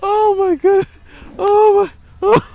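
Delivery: oh my God squeaked out laughing; helpless laughing fit; high squealing laughter. Real, not acted. Vlogger cracking up taunting Brady.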